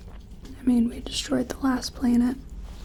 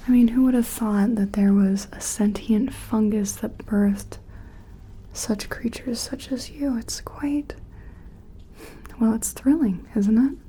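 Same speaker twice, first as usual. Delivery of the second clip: ordinary delivery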